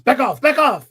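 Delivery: commanding shout. Retold or imitated. Retelling Kirk in battle, shouting back off. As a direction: yell the retreat order like a captain. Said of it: "and Kirk goes".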